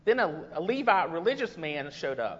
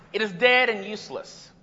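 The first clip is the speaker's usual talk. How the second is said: ordinary talk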